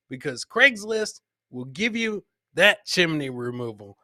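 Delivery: usual speaking voice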